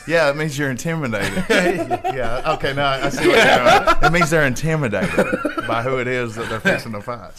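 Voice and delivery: loud laughing talk. Real, not acted. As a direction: laugh while you explain. Laughs mid-explanation, much louder.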